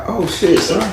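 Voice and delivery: high, mock sheepish apology. Retelling own embarrassed apology.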